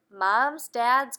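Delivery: plain reading voice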